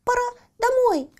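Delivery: very high little hare; piping little animal voice; small bunny voice. Acted. Зайчонок говорит. (тоненький зайчонок)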